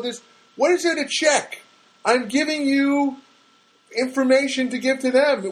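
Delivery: high-pitched, exasperated arguing; worked-up caller; irate. Retold or imitated. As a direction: argue back in irritated disbelief. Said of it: "I get irate".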